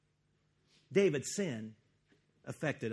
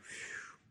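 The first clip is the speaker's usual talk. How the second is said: relieved phew; 11 dB quieter than usual. Retold or imitated. David relieved after Uriah's death.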